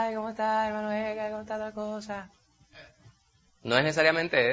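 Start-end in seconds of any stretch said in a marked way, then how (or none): 0.0-2.3 high, soft, sugary greeting; syrupy fake sweetness. Preacher mocking an overly sugary greeting to brother Edgar. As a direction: overdo the sweet talk. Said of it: "no es que usted diga".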